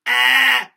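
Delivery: loud, high nasal scream; bright nasal yell; nasal scream demo. Shown as a demonstration. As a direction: scream it right in the nose. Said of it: "it's right in the nose there".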